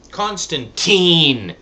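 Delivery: Constantine loud, high, last syllable long drawn out; emphatic con-stan-TEEN. Shown as a demonstration. Returning to the disputed title anyway, Constantine with a loud stretched "TEEN".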